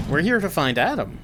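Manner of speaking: normal talking voice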